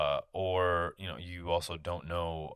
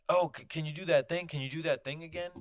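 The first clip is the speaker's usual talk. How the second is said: mock eager fan; 8 semitones higher than usual. Mocking people asking for impressions.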